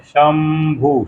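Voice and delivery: शंभु drawn out very slowly, the nasal dot said as m before bh; slow model pronunciation. Shown as a demonstration. Teacher shows listeners how to say शंभु, drawn out slowly. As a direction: stretch out शंभु so every sound is heard. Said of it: "उसको क्या पढ़ते हैं".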